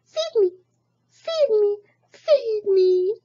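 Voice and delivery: very high whining duckling voice; hungry baby duck. Acted. Hungry baby duck demands food, very high. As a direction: chant a hungry plea. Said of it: "cried the hungry baby duck".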